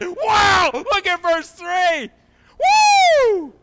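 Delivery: high, loud, slow excited shout. Retold or imitated. Pastor retelling his joy in the office, shouting excitedly. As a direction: relive the thrill of finding the verse. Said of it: "I was so excited in my office".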